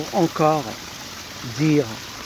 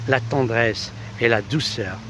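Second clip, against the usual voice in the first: normal talking voice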